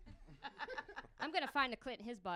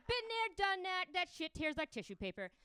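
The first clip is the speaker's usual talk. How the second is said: breezy retort; 7 semitones higher, 6 dB louder than usual. Retold or imitated. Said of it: "I'm like".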